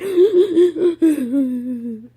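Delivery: sharp crying gasps, loud and high; gasping sob. Real, not acted. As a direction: catch a breath mid-sob.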